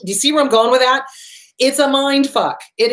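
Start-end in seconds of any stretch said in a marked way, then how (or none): none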